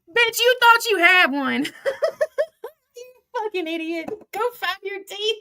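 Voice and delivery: high laughing jeer; mocking taunt. Real, not acted. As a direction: gloat at her through laughter. Taunting Lucy while laughing.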